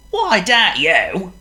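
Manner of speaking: lightly voiced helpful dog; drawn-out friendly dog voice; Wolf's voice. Voices Wolf.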